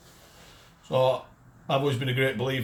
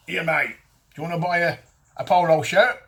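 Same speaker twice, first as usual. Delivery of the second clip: loud, higher-pitched southwest street seller; mock southwest accent; 5 semitones higher, 7 dB louder than usual. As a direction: hawk it in a shaky attempt at a southwest accent. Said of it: "what sort of accent that is".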